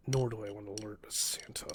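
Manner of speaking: hushed so no one wakes; whisper. Real, not acted. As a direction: keep it under your breath. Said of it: "don't wanna wake anyone".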